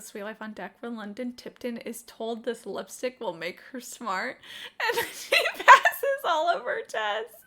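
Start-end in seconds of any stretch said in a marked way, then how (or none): 4.8-7.3 laughing while telling. Real, not acted. Recalling funny TV episode.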